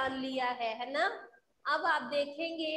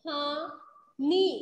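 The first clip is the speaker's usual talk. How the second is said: कहानी drawn out slowly and louder, rising; slow, loud reading; 6 dB louder, much slower than usual. Shown as a demonstration. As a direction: stretch कहानी for the class. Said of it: "क्या पढ़ेंगे हम इसे?".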